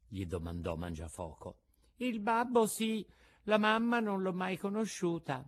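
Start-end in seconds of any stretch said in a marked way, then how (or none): 2.0-5.4 put-on high light puppet boy voice; small puppet voice. A narrator's reading. Pinocchio answers the puppet master about his parents, high childlike voice. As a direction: answer simply like a little wooden boy.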